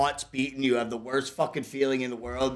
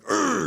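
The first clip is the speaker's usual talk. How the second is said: frustrated grunt; 12 semitones higher than usual. Retold or imitated. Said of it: "I was like".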